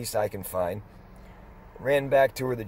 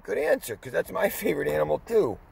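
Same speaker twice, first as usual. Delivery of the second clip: much higher, quick, laughing praise; delighted; 7 semitones higher than usual. Retold or imitated. Cheering the resident's answer, high and delighted. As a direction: reward her answer with glee.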